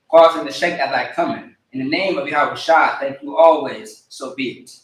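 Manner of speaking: ordinary talk, nothing marked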